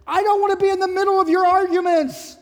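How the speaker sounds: loud, high-pitched protest; exasperated outburst. Acted. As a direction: refuse loudly to referee the fight. Pastor's acted outburst to callers.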